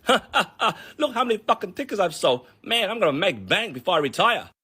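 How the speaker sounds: hammed-up villain counting money; gloating seller. Seller counting cash in back office, gloating laugh. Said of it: "this guy's evil laughter".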